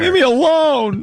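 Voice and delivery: very high mock-distressed cry; protesting actor. Harassed actor begging a fan to back off, high mock wail. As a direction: wail it as a hammed-up plea.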